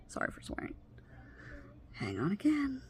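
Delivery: soft unvoiced apology under her breath; whispered aside. Real, not acted. Speaker apologizing to viewers mid-story, real whisper. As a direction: whisper it off to the side.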